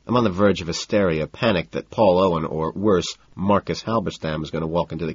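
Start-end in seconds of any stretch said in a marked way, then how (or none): none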